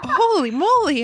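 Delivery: high, laughing holy moly; giggling high exclamation; laughing joy. Real, not acted. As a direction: burst out in happy disbelief. Laughing with joyful disbelief.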